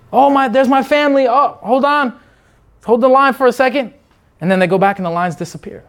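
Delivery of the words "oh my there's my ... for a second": distracted wanderer. Distracted believer acted out.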